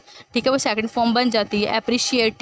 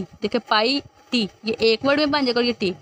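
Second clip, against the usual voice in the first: normal talking voice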